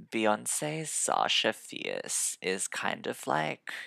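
lethargic vocal fry reviewer; vocal fry warmup. Pretend.

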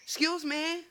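mock indignant excuse me. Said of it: "Especially going into the toilets".

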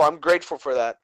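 usual speaking voice

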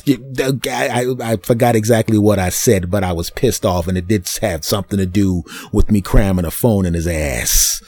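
ordinary talk

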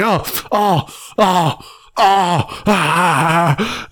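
slow, whimpering oh oh ah ah; pained moaning. Retold or imitated. Randy groaning while the general pisses on him, mock whimpers. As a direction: groan like you're being pissed on.

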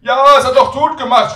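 put-on very high, booming old-lady holler; shouting granny. Grandmother gossiping about grandson, loud high shout. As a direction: shout it without knowing he still hears. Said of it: "schreit Oma".

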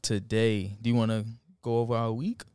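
normal talking voice

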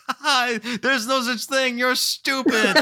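high, slow taunting jeer; cackling, hammed-up ridicule; mocking laughter. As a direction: imitate a dad laughing at his gullible child. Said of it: "just laughs and points at him".

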